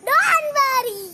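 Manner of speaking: comforting call. Acted. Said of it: "ഞാൻ എന്താ പറഞ്ഞത്?".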